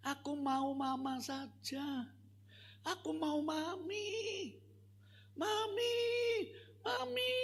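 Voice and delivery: put-on high, slow pleading little girl, rising to a wail; whining child. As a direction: beg for Mami like a small child. Child wants only mother. (anak kecil merengek memanggil mami)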